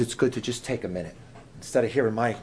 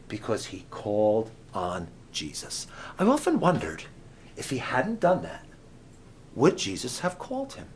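ordinary talk

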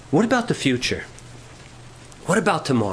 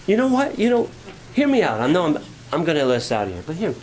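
ordinary delivery

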